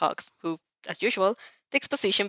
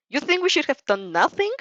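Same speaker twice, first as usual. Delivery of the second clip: higher, louder accusing question; hurt anger; 8 semitones higher, 4 dB louder than usual. Acted. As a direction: push back, feeling betrayed. Todoroki confronts the narrator angrily.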